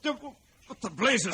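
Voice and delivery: high, loud, slow alarmed exclamation; startled. Acted. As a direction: jump at something shocking. Sudden alarm at the hut.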